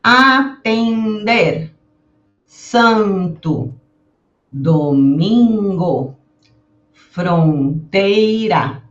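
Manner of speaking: each word drawn out slowly for the class; slow careful word list; slow model words. Shown as a demonstration. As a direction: stretch every nasal sound for learners. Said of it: "Veja só uma outra maneira de pronunciar".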